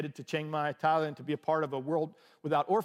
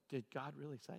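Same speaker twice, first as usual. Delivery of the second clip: low, much softer insinuating devil's voice; devil's whisper; 4 semitones lower, 17 dB quieter than usual. Retold or imitated. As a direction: sow doubt like a tempting devil. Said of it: "you could hear the devil going".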